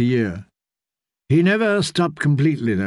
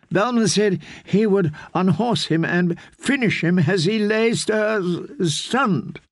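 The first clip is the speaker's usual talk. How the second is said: high, slow, stuttering shaken noblewoman; a battered wife stuttering through tears; stammering distraught lady; 7 semitones higher, slower than usual. Acted. Felice continues stammering.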